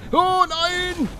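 very high cry of oh no; real high squeal of dismay; shrieked oh no. Real, not acted. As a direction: squeal it in panic. Character dying in game.

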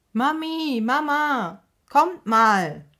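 high, loud call across a meadow; child shouting. A narrator's reading. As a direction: yell for both moms from far away. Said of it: "schreit Mika über die ganze Wiese".

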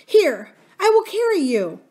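high, loud, urgent offer; frantic nurse. A narrator's reading. Nurse to princess who can't run, frantic offer. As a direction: urge it high and hurried.

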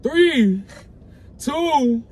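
high, loud called-out count; loud countdown. Real, not acted. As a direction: call the count out to the room. Counting down to the reveal again.

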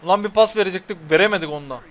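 fast heated grumble at the team; angry rant. Real, not acted. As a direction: spit it out fast in frustration. Complaining about failed pass.

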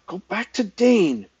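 high, loud, slow order; resentful command. A narrator's reading. Resentful page to stray dog, resentful command. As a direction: send him away firmly, word by word.

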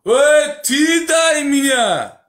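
high stretched yell; yelling across the room. Real, not acted. Quarrel, calling out.

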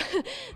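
short high-pitched giggle; quick bright laugh between lines. Real, not acted. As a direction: let a breathless little giggle out. Reporter laughs after breathless joke.

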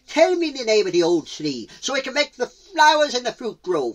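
loud, high cheetah voice; calling to spirits. Acted. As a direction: call out the request boldly. Cheetah calls to mountain spirits.

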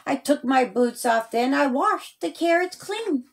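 usual speaking voice

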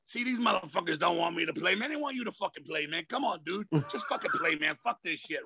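mild impression of a fed-up drummer friend; Dave's voice. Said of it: "I'll do Dave's voice 'cause it's how he was".

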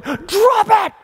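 high, loud shout at the dog; shouted command. Retold or imitated. Owner shouting at the dog in the hallway, high and loud. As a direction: yell at the dog to drop it. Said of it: "I remember just going".

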